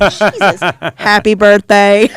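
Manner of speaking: slow, loud, fed-up happy birthday; exasperated loud mutter. Retold or imitated. Fed up at a birthday crowd, slow loud groan. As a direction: drag it out, sick of the cake fuss. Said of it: "I was like".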